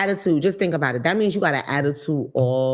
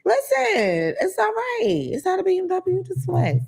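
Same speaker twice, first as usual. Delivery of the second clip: very high, drawn-up comic voice; mock placating. Customer to aggressive attendant, mock placating. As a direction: plead in an exaggerated high pitch.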